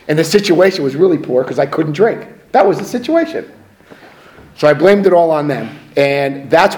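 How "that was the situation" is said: much higher, sarcastic; mock your old self's excuse, high. Mocking own whiny excuse.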